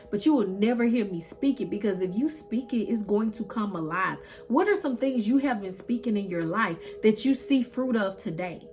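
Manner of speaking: usual speaking voice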